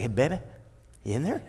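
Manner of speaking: a hint of a smooth-talking boyfriend; suave lover. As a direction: croon it like a charming suitor. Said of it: "He's like".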